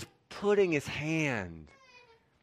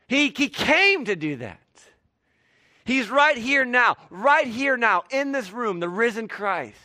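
higher and louder, urgent pulpit voice; full-force preaching; 6 semitones higher, 4 dB louder than usual. Acted. Preacher urging listeners that Christ is here, impassioned. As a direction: press the invitation home to the crowd.

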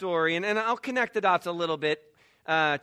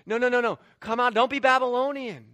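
urgent, raised call to come out; insistent, projected plea; prophetic call; 5 semitones higher, 5 dB louder, faster than usual. Acted. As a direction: call them out like a prophet, higher and louder. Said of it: "there's a call from the".

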